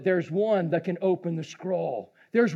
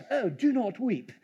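softer, put-on British reading; mock British accent; 3 dB quieter than usual. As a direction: play it as a prim British line. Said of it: "he even used a British accent in that?".

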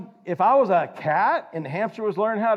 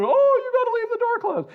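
much higher, put-on owner's voice; fussy pet owner; 3 dB louder than usual. Preacher voicing the hamster owner, high mock scold.

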